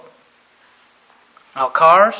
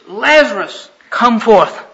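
preacher's raised-voice command; calling out; 4 semitones higher, 9 dB louder, slower than usual. Retold or imitated. Jesus calls Lazarus from the tomb, calling out. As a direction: call it out loudly toward the tombs.

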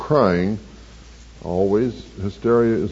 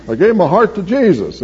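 higher and louder, bursting with joy; a retold convert blurting out his joy; excited testimony; 10 semitones higher, 7 dB louder than usual. New convert telling the pastor he gave his heart, high joyful exclaim.

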